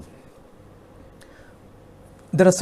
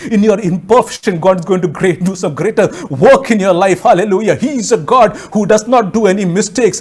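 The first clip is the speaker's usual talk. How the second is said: rising preaching; 4 semitones higher, 5 dB louder than usual. Real, not acted. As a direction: build from firm exhortation to a high, loud climax. Preaching builds to a climax.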